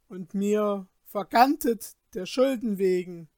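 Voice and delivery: loud, high, bitter complaint; upset complaint. Acted. Peter rails at the Glasmännlein over his ruin, loud and bitter. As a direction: heap the blame on him loudly.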